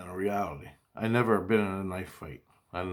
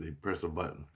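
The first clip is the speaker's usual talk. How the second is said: usual speaking voice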